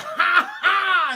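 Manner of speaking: loud, high-pitched "ha ha"; hammed-up gloating laugh; mock villain laugh. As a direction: burst out in fake triumphant laughter. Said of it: "he starts laughing".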